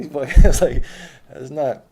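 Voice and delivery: loud laugh breaking into the words; real laugh while telling it. Real, not acted. Telling friends about a standoff, laughing.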